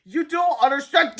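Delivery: slow, high, loud plea; flustered protest. A narrator's reading. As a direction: plead to be heard. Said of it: "Harold stammered".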